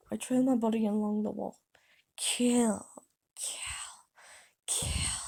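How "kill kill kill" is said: eerie drawn-out whisper; strange voice. A narrator's reading. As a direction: whisper it like a disembodied killer. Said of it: "I heard the voice again".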